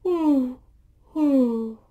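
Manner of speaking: loud high ooh; remembering grandparents, a loud pained ooh. Real, not acted. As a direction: wince out loud. Reacting to painful memory.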